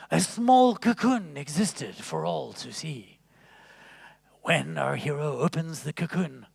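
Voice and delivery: a hint of a reverent wildlife narrator; David Attenborough. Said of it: "I'll be David Attenborough for you".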